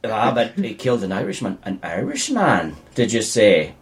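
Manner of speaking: low, mock stage-Irish scheming; hammy Irish villain. Mocking an over-actor, low hammy Irish voice. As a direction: ham it up like a bad Irish baddie. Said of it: "He's like".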